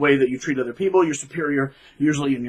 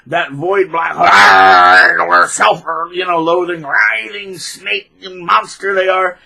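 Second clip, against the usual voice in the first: slow, louder, ominous booming; dramatic denunciation; 5 dB louder, slower than usual. Real, not acted. Condemning the narcissist as a monster, slow ominous boom. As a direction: intone it like a curse. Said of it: "that was just a habit when I was talking like that".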